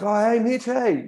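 hint of excited English fans saying hello. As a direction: greet the rider like excited fans. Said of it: "two English guys".

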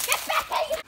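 high call after her. Acted. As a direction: yell after someone running. Calling after the fleeing creature.

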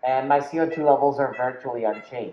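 muffled behind layered masks; speaking behind face shield; through a face mask. Real, not acted.

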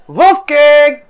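high, loud shout to someone inside; calling out. Acted. Player calls to Anderson inside the wolf, calling out. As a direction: holler to check on them, high-pitched.

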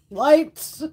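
normal talking voice

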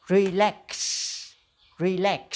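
Relax. ending clearly on ks; final ks sound. Shown as a demonstration. Teacher stressing the ks ending of Relax. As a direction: finish Relax. with a crisp ks. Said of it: "có âm cờ sờ".

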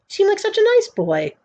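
very high, sweet voice; friendly smile. A narrator's reading. Executioner complimenting the boy, high and friendly. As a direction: lift it high and smile through it. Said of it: "looking at him with a friendly smile".

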